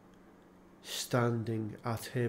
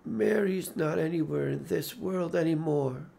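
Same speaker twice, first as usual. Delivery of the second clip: plain reading voice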